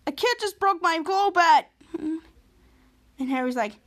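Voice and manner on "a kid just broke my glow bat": put-on higher, louder complaining kid voice; whiny kid tattling. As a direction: call out to mom like a tattling child. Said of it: "And he's like".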